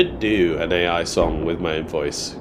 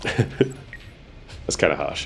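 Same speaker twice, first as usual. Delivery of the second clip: laughing; 3 semitones lower, 5 dB quieter, much slower than usual. Real, not acted. Laughing at chat comment.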